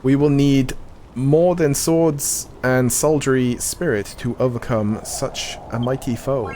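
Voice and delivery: measured, solemn NPC reading; game dialogue reading. Acted. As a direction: read it like a quest-giver's briefing. Reads game NPC dialogue aloud.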